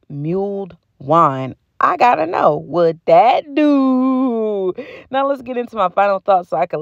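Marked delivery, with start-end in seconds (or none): 1.8-4.7 emphatic. Real, not acted. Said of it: "emphatic slow demand".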